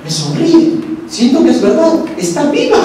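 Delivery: a woman amazed that the statue smiles at her; retold joyful awe. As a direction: gasp it out like seeing a miracle. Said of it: "Y la emoción".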